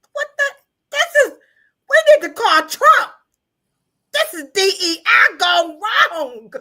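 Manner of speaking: high, loud shocked outcry. Real, not acted. Reacting to the clinical photos, high shocked outcry. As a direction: cry out in disbelief.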